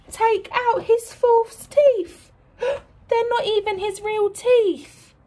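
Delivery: high, slow gasping disbelief; mock horror at the false teeth; shocked gasp. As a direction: gasp as if it's a scandal. Shocked reveal to the children.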